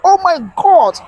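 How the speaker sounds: high-pitched cry of disbelief; shocked exclamation. Retold or imitated. Host reads a viewer's reaction to the death, shocked. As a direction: exclaim it high, as a stunned friend would.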